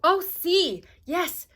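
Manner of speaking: high, loud eager yes; quick, keen helper agreeing; young helper agreeing to the rules; eager Big Anthony. A narrator's reading. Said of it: "said Big Anthony".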